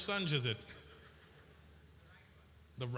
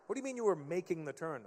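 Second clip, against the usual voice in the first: usual speaking voice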